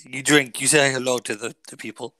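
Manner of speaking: badly faked Irish accent, softening off; Irish accent that could pass for Mexican; attempted Irish accent. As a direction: put on a dubious Irish lilt. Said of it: "That was my Irish accent".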